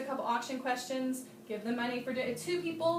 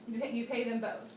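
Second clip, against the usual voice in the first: ordinary talk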